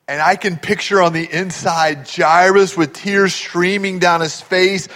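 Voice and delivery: normal talking voice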